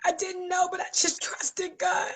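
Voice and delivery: whispered through tears; barely holding it together; emotional, breathy whisper; tearful whisper. Real, not acted. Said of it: "I'm getting emotional".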